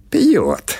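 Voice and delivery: put-on high cracked-up old woman's "Пьёт", falling off; old woman agreeing. Old baba Pasha agreeing with the watchman, in a high old voice. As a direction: squeak it like an old granny.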